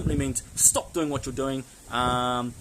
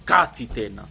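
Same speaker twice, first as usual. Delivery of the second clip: firm, louder, lower command; correcting behaviour; 5 semitones lower, 5 dB louder than usual. Shown as a demonstration. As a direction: tell someone off firmly. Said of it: "tell someone to stop doing that".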